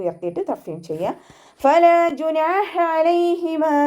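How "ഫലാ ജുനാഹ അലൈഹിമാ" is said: high, slow chanted verse; recitation demo. Shown as a demonstration.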